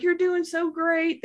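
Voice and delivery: high, slow, encouraging praise; encouraging doctor. Retold or imitated. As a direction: coax her along gently but brightly. Doctor encouraging during birth.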